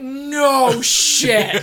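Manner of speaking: loud, high sarcastic no shit; mock retort. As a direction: snap it back at the screen.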